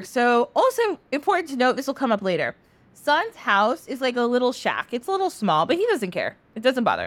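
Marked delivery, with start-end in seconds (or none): none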